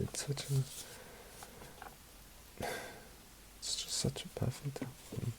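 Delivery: soft, broken tearful whisper; barely getting the words out through tears. Real, not acted. Vinyl reviewer tearing up over a beloved album, tearful whisper.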